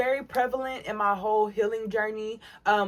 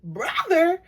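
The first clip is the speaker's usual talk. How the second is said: incredulous echo; 9 semitones higher, 5 dB louder, slower than usual. Retold or imitated. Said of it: "And I'm just thinking".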